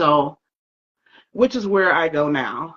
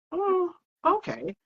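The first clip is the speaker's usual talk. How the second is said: stunned surprise; 13 semitones higher, 5 dB quieter, slower than usual. Retold or imitated. Taken aback by the stranger's remark.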